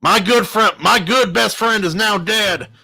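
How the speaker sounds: loud, high-pitched accusation; heated, loud reproach; accusing. A narrator's reading. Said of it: "Hardy said accusingly".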